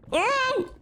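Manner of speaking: panicked high oh God no; terrified gibbering. Real, not acted. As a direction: shriek in terror, rising. Game panic, screaming.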